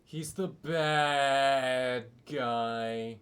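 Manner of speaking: ordinary talk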